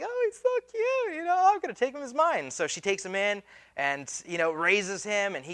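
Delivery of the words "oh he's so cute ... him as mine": very high cooing falsetto; imitated doting princess. Pharaoh's daughter finding baby Moses, mock doting coo. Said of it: "she's looking at him. She's like".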